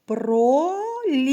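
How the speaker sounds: пролісок slowly split про - лі - сок; syllable by syllable. Shown as a demonstration. Teacher giving a word for syllable counting, slow syllabled пролісок. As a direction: sound out пролісок in three long even syllables. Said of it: "Наступне слово".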